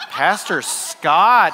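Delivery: slow, drawn-out mock reproach; judging. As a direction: imitate a congregation tut-tutting its pastor. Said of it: "Don't you judge me".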